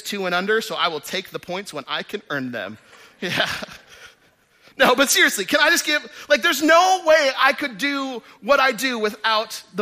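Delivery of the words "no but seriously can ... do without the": higher and louder, a heartfelt near-shout; earnest outburst. Real, not acted. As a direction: lift it up, proclaim it to the room. Preacher honors his wife.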